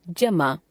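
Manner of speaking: जमा begun with a hard j sound; जमा as "jamaa", never z; plain Hindi j. Shown as a demonstration. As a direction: say the ज in जमा as j, without nukta. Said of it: "इनके उच्चारण में भिन्नता होती है".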